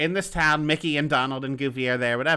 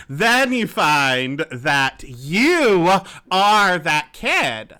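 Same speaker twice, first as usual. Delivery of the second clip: dramatic reveal; 2 semitones higher, 6 dB louder, much slower than usual. Real, not acted. Dramatic plot twist reveal.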